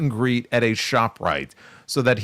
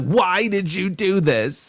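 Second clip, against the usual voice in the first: put-on high, drawn-out kid voice; upset little girl; 7 semitones higher, slower than usual. Retelling the daughter's plea to her father, high and slow. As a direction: imitate the crying girl, pitched up. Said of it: "the accent that she used".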